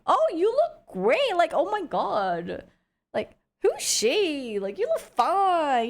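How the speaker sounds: high, slow, drawn-out gushing voice; gushing uncle. Retold or imitated. Uncle sees his grown niece on video chat, slow high gushing. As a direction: stretch it out in mock amazement. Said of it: "he was saying it in a way that's, like, really good".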